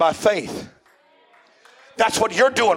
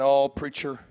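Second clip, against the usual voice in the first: imitating a weary churchgoer; 4 semitones lower, 4 dB quieter than usual. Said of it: "nobody's sitting there tonight saying".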